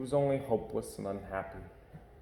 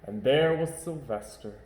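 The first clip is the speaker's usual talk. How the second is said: plain reading voice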